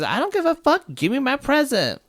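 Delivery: high, pushy little-girl voice, mock-voiced; a spoiled kid demanding her present, high; bratty girl demand. Spoiled girl to mall Santa, high mock demand.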